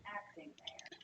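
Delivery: whispered aside. Real, not acted. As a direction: whisper the correction under breath.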